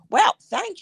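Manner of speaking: high, thrilled thanks; retold excitement. Thanking a friend for tickets, excited.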